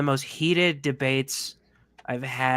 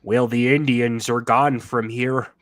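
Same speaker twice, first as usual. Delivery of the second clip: bad American accent; 5 dB louder than usual. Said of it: "Liam Neeson trying to play an American cowboy".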